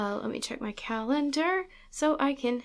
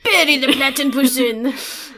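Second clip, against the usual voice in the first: put-on higher, much louder, slow silly German accent; mock German; 5 semitones higher, 11 dB louder, slower than usual. Host echoing the German accent joke, loud slow mock German. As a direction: echo the name slowly in a hammed-up German accent. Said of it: "making fun of German accents".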